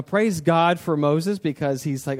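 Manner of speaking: usual speaking voice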